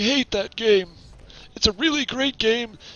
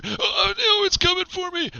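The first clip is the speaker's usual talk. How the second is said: mock panic; 6 semitones higher than usual.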